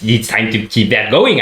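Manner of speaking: ordinary delivery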